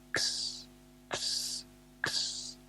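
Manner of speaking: X as an unvoiced "ks"; whispered letter sound. A narrator's reading. Reading the kisses on Max's birthday card, whispered "ks" for each X. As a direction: say each X as a soft, voiceless "ks", with pauses.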